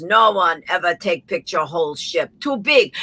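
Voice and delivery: dismissive mock Russian pilot; Russian accent. Russian pilot turning down the passenger's idea, Russian accent. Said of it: "the heavy Russian accent".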